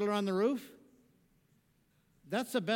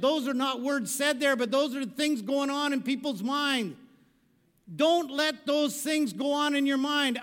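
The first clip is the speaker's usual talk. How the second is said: loud, higher pulpit exhortation; full-force sermon voice; preaching; 6 semitones higher, 7 dB louder than usual. Real, not acted. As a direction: drive it home to the congregation.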